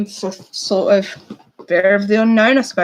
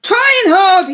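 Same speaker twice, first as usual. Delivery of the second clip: excited; 10 semitones higher, 8 dB louder than usual. Real, not acted. Reading winner announced excitedly.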